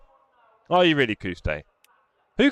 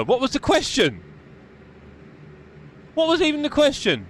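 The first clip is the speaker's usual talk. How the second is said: baffled; 10 semitones higher than usual. Real, not acted. Baffled by a viewer's complaint.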